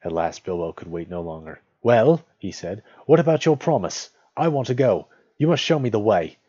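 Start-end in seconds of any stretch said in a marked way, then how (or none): 1.8-2.2 loud, raised rising call; impatient prompt. A narrator's reading. Bilbo can wait no longer. 3.1-6.4 high, loud insistence; raised, forceful hobbit voice; impatient demand. A narrator's reading. Bilbo impatiently demands his promise.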